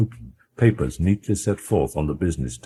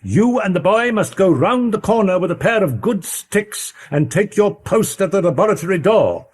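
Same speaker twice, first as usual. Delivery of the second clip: a higher, urgent lawyer voice giving commands; brisk, firm orders to the butler, pitched up; Utterson's orders; 14 semitones higher, 4 dB louder than usual. Acted.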